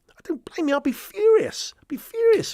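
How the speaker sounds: high mocking furious voice; squeaky mocking outrage.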